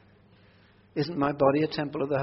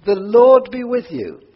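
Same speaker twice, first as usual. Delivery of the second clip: loud, slow churchly call to the congregation; liturgical call; 8 semitones higher, 12 dB louder, slower than usual. Shown as a demonstration. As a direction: proclaim it like the opening of a service. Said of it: "I'm going to do a little bit of liturgy".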